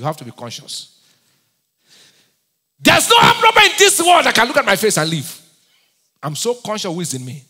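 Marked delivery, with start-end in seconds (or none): 2.8-5.4 loud, high boast from the pulpit; full-force preaching. Acted. Preacher proclaiming his confidence to the church, loud. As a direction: declare it boldly, full voice.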